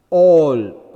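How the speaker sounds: all, very loud, with a long aw; loud, lengthened "all"; stressed long O. Shown as a demonstration. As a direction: lean hard on the vowel of all. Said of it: "O is stressed".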